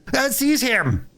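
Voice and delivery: high, alarmed shout; crying out. A narrator's reading. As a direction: raise the alarm. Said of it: "they cried".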